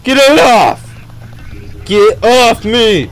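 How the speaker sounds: slow drawn-out frantic scream; panicked yelling. Real, not acted. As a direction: yell to shake it off. Grabbed by monster.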